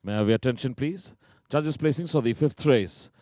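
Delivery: ordinary talk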